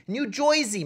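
New Jersey native saying "JOY-zee" for Jersey; demonstrating New Jersey as "New Joisey"; New Jersey accent. Shown as a demonstration. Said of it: "that's how you say the word New Jersey in New Jerseyan".

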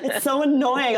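mock-annoyed through a laugh; amused grumble with a laugh. Real, not acted.

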